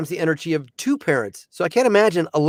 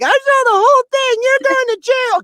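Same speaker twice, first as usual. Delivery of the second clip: very high, loud busybody lady; shrill accusing lady; 4 dB louder than usual. Retold or imitated. Bystander lady accusing the dad, high and loud like a seagull. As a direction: squawk it at him like a seagull from a picnic. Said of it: "comes at me like a seagull from a picnic".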